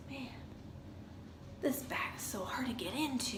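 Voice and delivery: breathy whispered complaint to herself; hushed frustrated aside; whispered grumble. Retold or imitated. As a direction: whisper it under your breath, annoyed. Inner grumble at doctor's office.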